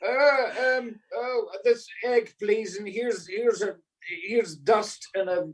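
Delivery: put-on high-pitched, stammering panicky kid; a flustered child fumbling at the counter; panicked kid. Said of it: "the kid went in to buy cigarettes and panicked".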